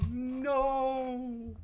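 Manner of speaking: sudden high cry of no; high dismayed no. Real, not acted. Gamer yelps at a game setback, high no. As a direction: yelp it out in dismay.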